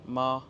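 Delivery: top-register, unchanging pitch on ma; first tone. Shown as a demonstration. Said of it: "premier ton, le plus aigu".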